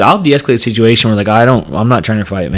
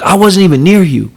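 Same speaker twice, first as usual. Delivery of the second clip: higher, louder whiny driver voice; mocking the driver; 8 semitones higher, 3 dB louder than usual. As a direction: mimic the guy's defensive whine. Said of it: "So he's like".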